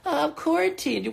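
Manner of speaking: high, whiny mock voice; whiny fake excuse. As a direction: imitate a whiny excuse-maker. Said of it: "We pretend".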